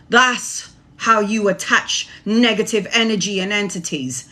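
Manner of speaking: ordinary delivery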